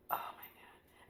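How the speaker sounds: hushed whisper in awe. Retold or imitated. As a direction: breathe it out as a whisper. Retold awe in the church.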